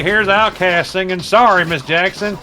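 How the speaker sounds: high-pitched mock country DJ introduction; imitated radio announcer, lifted pitch. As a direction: announce the next hit like a country host. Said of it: "the country stations were playing".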